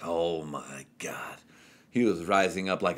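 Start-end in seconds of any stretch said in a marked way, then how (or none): 0.0-1.4 hushed whisper, in awe; soft awed whisper. Retold or imitated. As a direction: breathe it out in amazement. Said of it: "unvoiced whisper".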